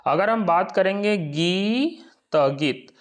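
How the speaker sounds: गी त गीत split into syllables, then गीत said whole and slowly; teacher slowly breaking गीत into गी and त; syllable demo. Shown as a demonstration. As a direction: say गी, then त, then गीत, with no full अ after त. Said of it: "तो गीत बोल दिए तो त के लास्ट में अ जो आया उसका पूरा उच्चारण नहीं".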